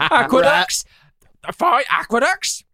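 high-pitched, laughing mock query; hammed-up high questioning voice; comic film quote. Quoting Monty Python scene, laughing.

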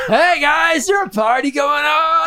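high, loud party-crasher call; eager latecomer. Acted. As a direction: call out like a clueless late arrival. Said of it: "You were like".

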